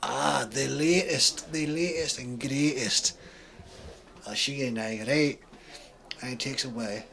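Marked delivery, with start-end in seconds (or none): 0.0-5.4 put-on thick Scottish accent. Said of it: "He has a thick Scottish accent".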